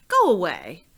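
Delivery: a sudden loud bark of a cross dragon; annoyed dragon snapping at the door; grumpy dragon. Acted. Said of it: "and he said".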